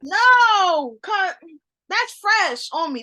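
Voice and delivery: high, loud upset protest. Real, not acted. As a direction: protest loudly, still stung. Still upset about the parallel.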